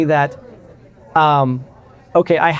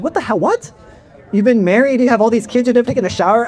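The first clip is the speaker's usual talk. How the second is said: high, incredulous disbelief, pitched way up; hammed-up shock, voice jumping up on the second What; mock astonishment; 6 semitones higher than usual. New wife, hearing he never showered with anyone, astonished and high. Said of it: "And she's like".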